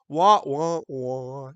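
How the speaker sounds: sad-trombone wah wah, sinking away; mock sad trombone. Teacher mocking Sherry's defeat with a sad trombone noise. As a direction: play a jokey losing-horn noise.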